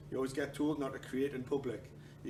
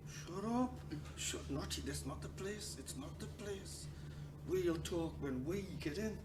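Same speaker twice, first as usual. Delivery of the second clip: urgent, much softer hush; hushed telling off; 10 dB quieter than usual. Retold or imitated. Partner hushing a row in public, urgent soft hiss. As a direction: keep it down, it's not the place.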